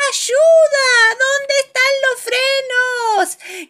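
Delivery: chillido agudísimo de pánico; grito muy asustado. A narrator's reading. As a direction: grita pidiendo auxilio, alargando las palabras. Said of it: "grita mamá Pig, muy asustada". (pánico a gritos)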